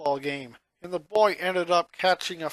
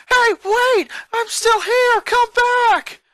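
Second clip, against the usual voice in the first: very high, loud shout after a leaving bus; desperate yell; 10 dB louder, slower than usual. Acted. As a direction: call out to stop the bus. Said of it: "his cries fell on deaf ears".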